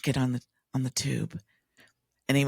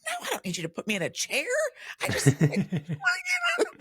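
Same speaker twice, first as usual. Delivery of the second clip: high-pitched comic refusal; hammed-up flustered objection; mock protest; faster than usual. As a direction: protest quickly, rising into a laughing squeal. Acted protest to overeager helpers.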